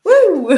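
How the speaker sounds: a high, loud party whoop; excited whoop. Pretend. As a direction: cheer like the crowd greeting the star. Cheering [name]'s grand arrival. (cri de joie aigu et fort)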